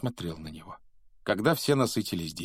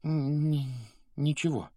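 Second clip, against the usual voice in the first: higher, halting stammer; forced out; 7 semitones higher than usual. A narrator's reading. Harry answering Percy's question, forced out haltingly. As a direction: squeeze the word out with difficulty. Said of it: "с трудом выдавил из себя Гарри".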